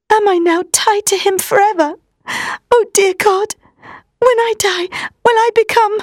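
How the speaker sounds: high, frightened, near tears; pleading in growing fear; tearful, rising panic. Acted. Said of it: "There were tears in her eyes".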